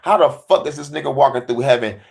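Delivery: ordinary talk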